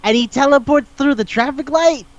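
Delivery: high-pitched baffled recap; incredulous. Real, not acted. Baffled at absurd movie plot.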